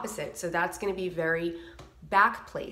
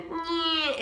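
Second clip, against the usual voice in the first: nasal; 10 semitones higher than usual. Shown as a demonstration. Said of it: "like your nose is plugged up".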